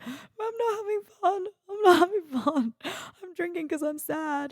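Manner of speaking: high, wailing sob-voice, retold; crying. To the cab driver, in a high tearful wail. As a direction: wail it out like a heartbroken girl in tears.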